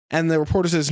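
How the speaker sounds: ordinary talk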